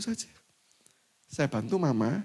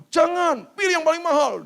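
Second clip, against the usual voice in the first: high, loud insistence; insistent mother; 7 dB louder than usual. Retold or imitated. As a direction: overrule him eagerly. (ibu bersikeras dengan suara tinggi dan keras)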